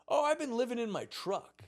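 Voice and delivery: high, breezy confession; lightly voiced old surfer, unbothered; a hint of Paul's easy voice; casual old surfer. Voicing Paul.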